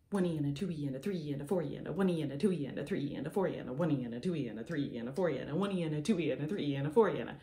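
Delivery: rapid sixteenth-note count; fast counting. Shown as a demonstration. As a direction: rattle off the count quickly. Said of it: "we just shake the whole time pretty fast".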